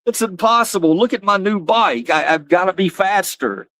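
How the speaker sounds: high, stunned, indignant protest; the sore loser insisting it can't be; mock indignant kid. Kid who just lost the race, in mock high indignation. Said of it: "I was so angry".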